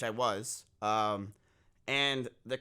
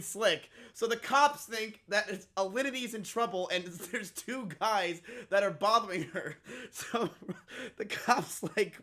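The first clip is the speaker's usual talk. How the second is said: higher voice breaking into laughs; laughing while talking; 5 semitones higher than usual. Real, not acted. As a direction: tell it through real amusement, laughter bursting in. Cracks up while telling the mishap.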